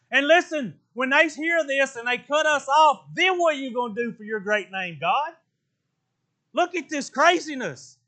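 ordinary talk

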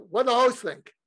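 put-on loud, high Brooklyn accent; Larry King. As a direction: demand an answer in Brooklyn accent. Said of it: "Even with his Brooklyn accent".

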